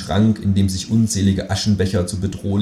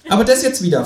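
much higher, pert kangaroo voice; cheeky kangaroo voice; 12 semitones higher, 3 dB louder, faster than usual. Acted. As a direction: play the kangaroo as a sassy, high-voiced know-it-all. Känguru dialogue.

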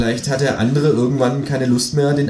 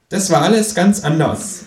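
high-pitched creature voice, objecting; Känguru; 8 semitones higher, 4 dB louder than usual. A narrator's reading. Kangaroo corrects the story in a footnote, high objecting voice. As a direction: put the record straight, pitched up high. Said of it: "Anmerkung des Kängurus".

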